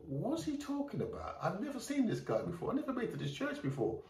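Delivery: as if a stranger claimed to know you, half laughing in disbelief; baffled disbelief. Retold or imitated.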